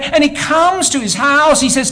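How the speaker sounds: ordinary delivery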